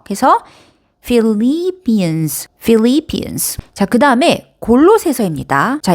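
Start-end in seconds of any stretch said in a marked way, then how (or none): 1.0-2.5 Philippians, slow, long LIP as "lee"; stress on second syllable. Shown as a demonstration. Teacher modelling for learners, Philippians stressed on the second syllable. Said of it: "두 번째 음절에 강세가 있습니다". 2.6-3.6 Philippians with the second syllable as a strong "lee"; stress on second syllable. Shown as a demonstration. Teacher repeating for learners, Philippians stressed on the second syllable. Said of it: "두 번째 음절에 강세가 있습니다".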